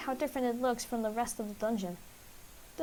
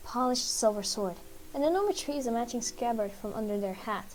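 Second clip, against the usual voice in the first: ordinary delivery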